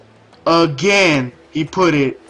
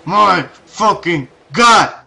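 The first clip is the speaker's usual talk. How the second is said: slow, loud, word by word; loud, drawn-out, pitched-up exasperation; exasperated outburst; 4 semitones higher, 6 dB louder, much slower than usual. Retold or imitated. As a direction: punch each word separately in disbelief. Exasperated at third satellite job.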